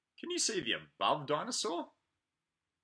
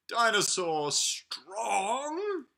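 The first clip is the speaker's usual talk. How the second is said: strong heavy voice; 4 semitones higher, 5 dB louder, much slower than usual. Acted. Reading strong page.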